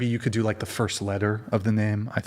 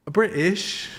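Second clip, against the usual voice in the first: higher, hammed-up British; British accent; 7 semitones higher than usual. Streamer tries British, a hammed-up one-word Brit accent. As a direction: pop the word out in a posh Brit voice. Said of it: "I don't know if I can do Brit".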